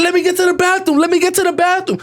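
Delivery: put-on high, urgent child's plea; pleading first-grader. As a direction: beg to be let through. Acted his child self pleading.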